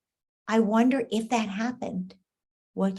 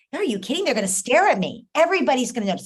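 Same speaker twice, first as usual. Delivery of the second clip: higher, louder worried protest; a light imitation of a teen daughter protesting; anxious daughter; 5 semitones higher, 5 dB louder, faster than usual. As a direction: play a teen sure everyone will stare. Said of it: "She'll be like".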